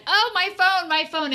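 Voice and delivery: imitating a flustered friend mistaking it for her phone; a friend's alarmed "oh, my phone" on the subway, lightly voiced; mock startled friend. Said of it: "she was like".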